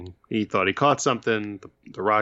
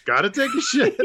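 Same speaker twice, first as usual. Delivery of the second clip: high, laughing mock knock-at-the-door voice; mock urgent stranger; 14 semitones higher, 3 dB louder than usual. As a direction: blurt it through a laugh. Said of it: "someone's like".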